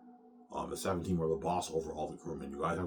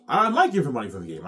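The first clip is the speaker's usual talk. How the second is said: excited outburst; 8 semitones higher, 13 dB louder than usual. Real, not acted. Excited boasting about the deal.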